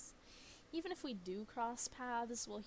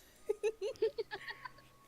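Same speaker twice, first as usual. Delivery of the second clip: high-pitched burst of laughter; loud giggling fit; 13 semitones higher, 6 dB louder than usual. Real, not acted. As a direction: crack up after the fake death. Laugh after mock death.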